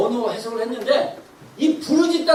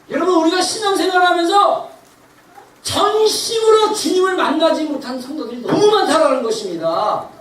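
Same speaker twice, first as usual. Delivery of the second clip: raised, louder sermon delivery; impassioned preaching; 7 semitones higher, 7 dB louder than usual. Real, not acted. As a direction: drive each phrase home to the congregation. Preaching at full force. (힘차게 외치는 설교)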